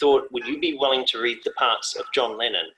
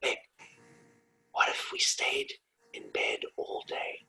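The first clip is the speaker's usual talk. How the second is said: hushed, unhurried bedtime whisper; acted soft whisper; whispered pitch; 11 dB quieter than usual. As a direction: whisper a big idea to a drowsy partner. Acting whispered bedtime idea.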